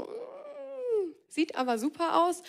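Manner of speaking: long high "mm" of craving; playfully acted temptation; tempted Eve. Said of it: "Und Eva ist so".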